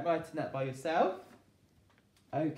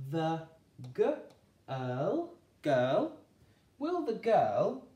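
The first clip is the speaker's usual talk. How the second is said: slow, raised, each word drawn out; a teacher drawing the words out for children; slow careful blending; 5 dB louder, much slower than usual. Shown as a demonstration. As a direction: build the phrase slowly, word by word. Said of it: "sounding out the sentence slowly".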